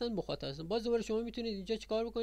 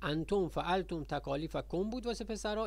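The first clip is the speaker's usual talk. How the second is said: ordinary talk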